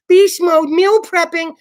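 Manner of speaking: high, loud hashtag-bragger voice; mock fitness poster. Imitating people tracking meals on the internet, high mocking boast. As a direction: imitate an overexcited gym poster.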